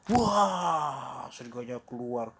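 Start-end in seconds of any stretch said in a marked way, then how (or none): 0.1-1.3 high, loud drawn-out "waah"; wolf's roar. Acted. Wolf throws off disguise, mock roar. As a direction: burst out to scare.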